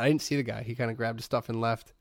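normal talking voice

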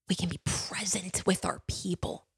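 soft, choked-up whisper, close to tears; getting emotional. Real, not acted. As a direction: let the words catch as the tears come. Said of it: "I feel a little emotional here".

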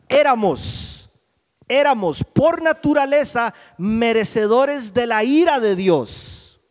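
loud, high scripture proclamation; emphatic preacher reading scripture; full-force preaching. Real, not acted. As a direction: proclaim the verse to the whole church.

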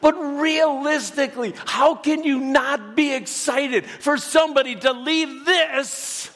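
preaching that a believer's funeral is joyful, building excitement. Real, not acted. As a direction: build from eager to an ecstatic high cry.

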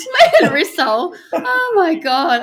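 high, slow, through laughter; laughing. Real, not acted. Cracking up while retelling the meme. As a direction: laugh the words out.

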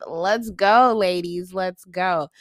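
loud rally cheer. Real, not acted. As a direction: hype the ladies up. Cheering for women.

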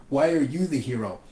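normal talking voice

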